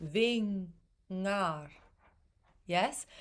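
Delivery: normal talking voice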